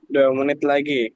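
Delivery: slow and clear. Real, not acted. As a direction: say it again slowly for clarity. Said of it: "Dua apa?".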